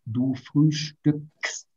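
du frühstückst drawn out, every consonant clear; slow it right down for the clusters; very slow demo. Shown as a demonstration. Teacher showing students the consonant cluster, very slow. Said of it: "Muchos consonantes".

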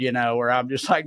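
ordinary talk

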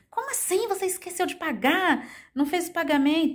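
high-pitched, incredulous scolding; cobrança indignada. Acted. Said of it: "ser aquela pessoa". (cobrança indignada, em tom agudo)

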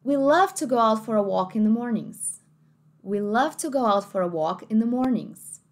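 rhotic American read of the line; American accent. Shown as a demonstration. Teacher demonstrating American pronunciation to students. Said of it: "Inglês americano".

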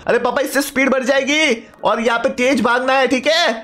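son's high, chatty voice; eager son. Acted. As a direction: play the excited kid calling out to papa.

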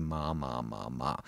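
low, soft plodding "ma"; unchanged spoken tone. Shown as a demonstration. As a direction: drop low and quiet on each "ma". Imitates how people sing the exercise.

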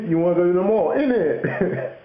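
mock London speaker, pitched high; London slang. Inviting someone to the mall, mock London slang. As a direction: imitate a Londoner, voice lifted high. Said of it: "a lot of people will be like".

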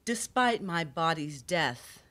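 slow, measured poem recital with long pauses; poetry reading. Acted. Poet reading a poem on history to an audience, slow solemn recital. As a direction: read each phrase as its own weighted line, slowly.

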